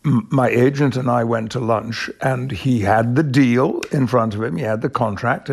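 usual speaking voice

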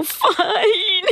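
high, cracked laugh through the word; laughing fine. Retold or imitated. To the therapist, laughing high denial. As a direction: squeak it out, laughing at herself.

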